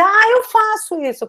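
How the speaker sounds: a student's sudden high "aha", lightly voiced; mock student. Teacher mimics a student recognising their own habit, lightly voiced. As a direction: chirp it up high like a pleased student. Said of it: "Aí você vai olhar e vai dizer".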